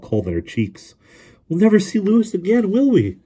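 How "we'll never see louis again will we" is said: put-on very high, wailing child's despair; acted tearful wail, pitched way up; sobbing. A narrator's reading. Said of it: "she sobbed".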